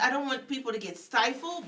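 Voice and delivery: ordinary delivery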